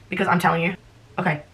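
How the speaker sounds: ordinary talk, nothing marked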